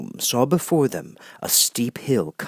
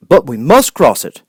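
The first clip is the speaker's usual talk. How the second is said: louder Scarecrow pushing back; firm insistence; 5 semitones higher, 9 dB louder than usual. Acted. Scarecrow refusing the Hammerhead, louder insistence. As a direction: stand your ground.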